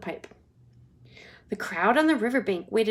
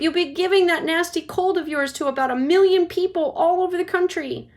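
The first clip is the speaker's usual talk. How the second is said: high, loud nagging scold; nagging mother; 8 semitones higher, 6 dB louder than usual. Acted. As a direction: nag him about his cold. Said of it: "shouted Missus Gloop".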